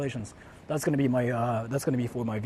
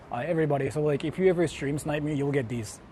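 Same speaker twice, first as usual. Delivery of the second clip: normal talking voice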